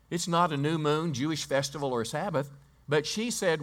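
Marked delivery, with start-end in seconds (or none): none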